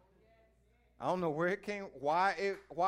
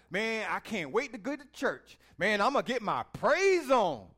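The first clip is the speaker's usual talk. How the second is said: eager churchgoer; 5 semitones higher, 7 dB louder than usual. Retold or imitated. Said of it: "You hear people talk like that?".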